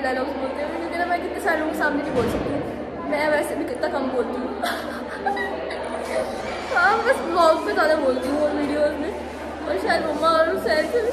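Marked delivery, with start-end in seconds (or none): 4.5-6.7 high unvoiced giggle; high airy giggle; laugh. Real, not acted. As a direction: giggle at herself. Laughs at her own claim.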